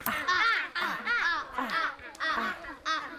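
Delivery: playful repeated ah with a laugh. Shown as a demonstration. Teacher showing children the ant sound, playful. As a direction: chant the ah sound lightly. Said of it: "Can you do that?".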